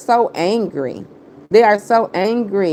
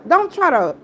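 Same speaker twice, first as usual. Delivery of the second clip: high angry; 8 semitones higher than usual. Real, not acted. Scolding rival over her child.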